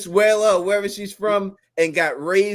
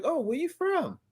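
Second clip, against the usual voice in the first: high, soft curious question; curious chat-up; 10 semitones higher, 7 dB quieter than usual. Acted. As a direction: play an intrigued stranger, pitched up and soft.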